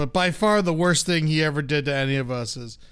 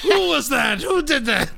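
feigned outrage; 9 semitones higher than usual. Retold or imitated. Dad turning round after his own prank, mock outraged shout.

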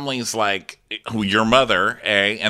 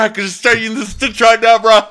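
much higher and louder, a hammed-up dudebro threat; mock bro voice; 13 semitones higher, 9 dB louder than usual. Retelling the boyfriend's ditch joke, mock bro voice. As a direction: ham it up like a meathead bro joking about violence. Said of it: "He said".

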